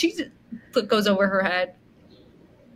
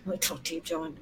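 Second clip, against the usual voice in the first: much softer, low and threatening; menacing low murmur; 4 semitones lower, 13 dB quieter, faster than usual. Retold or imitated. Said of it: "Carlos is like".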